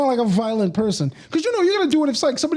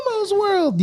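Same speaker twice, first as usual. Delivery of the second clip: Elmo voice; 10 semitones higher, slower than usual. Pretend. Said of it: "I don't think I could do it anymore".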